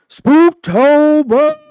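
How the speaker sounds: screamed very high and very loud, drawn out; spooky scream. Acted. Host screams the month's name into the mic as a wild spooky shriek. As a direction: shriek the word like a horror-movie host. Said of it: "when I just screamed into it".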